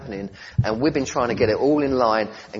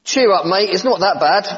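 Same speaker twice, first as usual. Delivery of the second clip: higher and louder, a chummy brush-off; breezy "cheer up" jokingly put in the angel's mouth; mock jolly pep talk; 7 semitones higher, 5 dB louder than usual. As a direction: play a hearty mate slapping him on the back. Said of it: "Oh, sorry, I've got that wrong".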